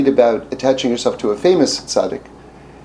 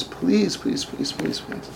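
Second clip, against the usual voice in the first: begging whisper; 5 semitones higher, 10 dB quieter, slower than usual. Retold or imitated. Retold begging.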